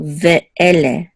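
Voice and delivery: Ve'ele as ve, pause, e-le; separated sounds. Shown as a demonstration. Teacher showing it here also, Ve'ele split after ve. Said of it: "and here also".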